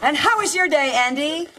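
high, sweet TV housewife; exaggerated sitcom mom. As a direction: ask the son brightly, overplayed. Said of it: "are you sure you want it that exaggerated".

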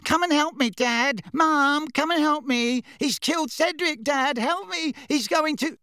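very high mocking pleas; high-pitched whimpering. A narrator's reading. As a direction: mimic whimpering cries for help. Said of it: "adopted a high-pitched, whimpering voice".

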